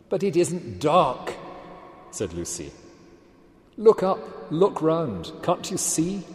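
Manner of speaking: Lucy begging the dwarves to look, high and slow; pleading girl. Acted.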